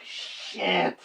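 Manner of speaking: breathy whispered "shit"; whispered curse. Retold or imitated. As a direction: hiss it under your breath. Quoting himself dreading the worst.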